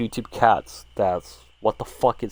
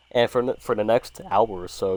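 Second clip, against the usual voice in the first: usual speaking voice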